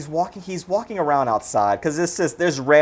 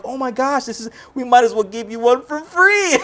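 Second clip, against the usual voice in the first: imitated; 9 semitones higher than usual. Prostitutes voiced, high, cry.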